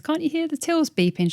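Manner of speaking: high teasing question; mock incredulous. Celebrity asking on the phone, teasing. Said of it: "She goes".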